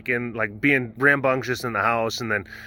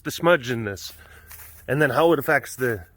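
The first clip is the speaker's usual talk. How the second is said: ordinary talk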